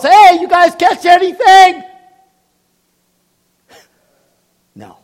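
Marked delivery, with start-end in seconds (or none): 0.0-1.8 loud, high hail across the water; yelling from the beach. Retold or imitated. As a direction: call out loudly to the boat from far off. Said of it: "this joker from the beach yells".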